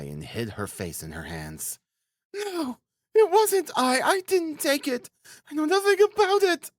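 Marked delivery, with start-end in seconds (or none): 2.3-6.7 put-on very high, desperate girl's cry; heartrending wail. A narrator's reading. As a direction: wail your innocence, pitch far up. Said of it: "cried with a heartrending wail".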